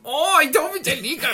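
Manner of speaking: very high, loud mock alien accent; contrasting a goofier accent with the French one; put-on alien accent. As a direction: pitch it high in a goofy accent. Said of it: "less offensive to do that than".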